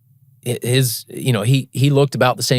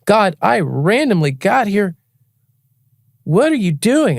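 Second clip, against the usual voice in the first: higher, slow mock exasperation; incredulous complaint to God; 8 semitones higher, slower than usual. Complaining to God about the random passage, exasperated. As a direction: throw up your hands at God. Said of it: "I'm like".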